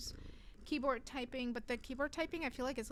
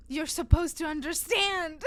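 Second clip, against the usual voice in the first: mock serious indignation; 3 semitones higher, 9 dB louder than usual. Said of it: "they say it with a serious face".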